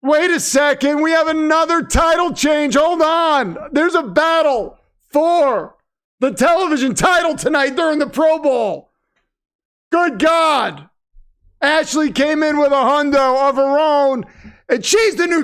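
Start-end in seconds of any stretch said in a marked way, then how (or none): 0.0-8.9 urgent hyped announcer; excited breaking news. Real, not acted. Excited breaking news of title change. 9.9-10.9 excited announcer exclamation. Pretend.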